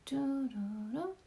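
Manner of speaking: soft slow waiting hum, doo-doo-doo; lazy under-the-breath humming; idle hum. Real, not acted. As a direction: hum to yourself to fill the silence. Hums while waiting for chat.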